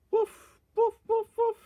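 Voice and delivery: high-pitched pretend woofs; imitated dog bark. Barking like a dog between remarks, high imitated woofs.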